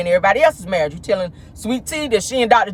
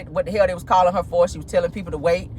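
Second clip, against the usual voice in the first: ordinary delivery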